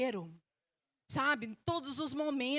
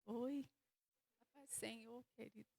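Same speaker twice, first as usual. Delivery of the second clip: fake polite greeting; 14 dB quieter than usual. Moody woman greeting someone at church, feigned quiet politeness. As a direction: mumble a reluctant hello, hiding the bad mood.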